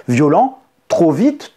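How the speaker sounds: usual speaking voice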